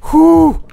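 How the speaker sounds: loud whew of relief; relieved sigh. Real, not acted. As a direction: blow out a big relieved breath. Close call survived, exhale.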